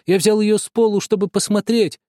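high, anxious repeated denial; insistent pleading. A narrator's reading. Boy keeps denying to the family, anxious. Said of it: "и только твердил".

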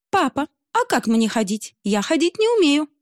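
high sweet coaxing voice; coy daughter. Acted. Русалка кокетливо спрашивает отца, как ходить, высоко и сладко. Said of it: "сделала губы сердечком".

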